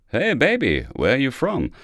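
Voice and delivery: laid-back flirt; cool. Retold or imitated. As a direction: play it casually cool. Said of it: "fragte der kleine Mann ganz cool".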